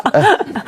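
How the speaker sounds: high burst of laughter. Real, not acted. Croc victim laughing retelling reunion, high bursting laugh. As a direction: laugh at the absurdity.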